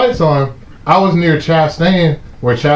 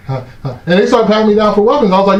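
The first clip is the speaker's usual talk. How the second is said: normal talking voice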